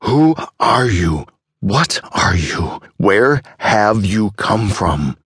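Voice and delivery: slow, emphatic questioning, mildly angry; angry demand. A narrator's reading. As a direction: demand answers, stretching each question out. Shaken doctor confronts the old man.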